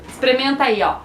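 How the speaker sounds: usual speaking voice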